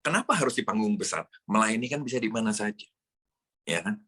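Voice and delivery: usual speaking voice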